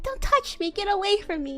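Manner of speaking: high, upset push-away; hammed-up heartbroken girl snapping; tearful outburst. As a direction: shove him off with your voice. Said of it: "she's like".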